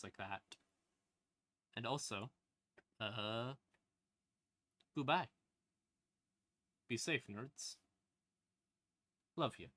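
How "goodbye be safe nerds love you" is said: ordinary talk, nothing marked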